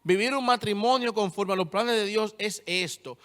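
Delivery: ordinary talk, nothing marked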